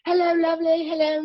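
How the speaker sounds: put-on British accent, sweet and bright hello. Actor imitating Florence greeting him in a British accent. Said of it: "she's British".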